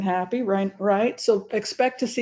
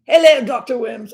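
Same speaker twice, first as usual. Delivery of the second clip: Harry greeting; 4 semitones higher, 10 dB louder than usual. Retold or imitated. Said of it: "it was like".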